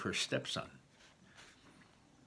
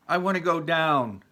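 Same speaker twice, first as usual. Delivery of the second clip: put-on scared little girl; 7 semitones higher, 6 dB louder than usual. Frightened child wants down.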